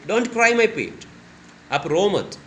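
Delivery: high, slow soothing plea; mother hugging her crying daughter, soothing; comforting mother. Shown as a demonstration. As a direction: coo it to calm a crying child. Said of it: "बोल रही है".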